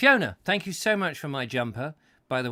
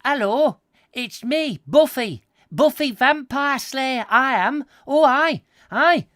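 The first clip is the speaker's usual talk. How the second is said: high, loud northern English Buffy voice; 7 semitones higher, 5 dB louder than usual. Pretend. Northern Buffy explains slaying vampires, high and loud northern accent. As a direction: ham up a northern slayer. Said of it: "coming from somewhere up north".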